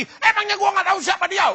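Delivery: high-pitched mock angry shout; hammed-up quarrel outburst, flung back high; angry retaliation. Angry person retaliates in a quarrel, hammed-up shouting. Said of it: "sudah balas lagi".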